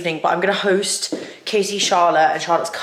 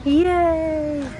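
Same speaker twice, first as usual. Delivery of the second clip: excited cheer; 10 semitones higher, 4 dB louder than usual. Real, not acted. Cheering halfway through run.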